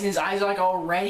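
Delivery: ordinary talk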